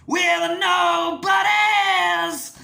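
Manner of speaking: slow, high, loud strained scream. Retold or imitated. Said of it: "[name] kind of".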